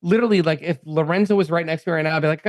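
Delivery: ordinary talk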